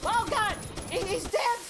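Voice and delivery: high, loud cry of shock; shocked yell. Real, not acted. Viewer reacting to a cartoon death, shocked yell.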